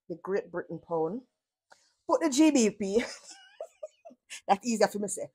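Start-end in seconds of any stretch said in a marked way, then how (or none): 3.6-4.1 short laugh. Real, not acted. Viewer laughs at own phrasing.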